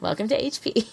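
soft laugh under the words. Real, not acted. Wry welcome to the fandom, laughing. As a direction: chuckle it out as a joke. Joking about the fandom's weirdness.

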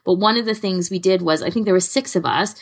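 usual speaking voice

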